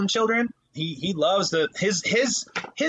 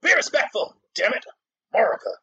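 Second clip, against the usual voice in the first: high, loud patriotic bark; hammed-up angry rant about the pledge; mock furious yell; 7 semitones higher, 4 dB louder, faster than usual. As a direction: bellow it like a drill sergeant. Mock yelling at crying kid.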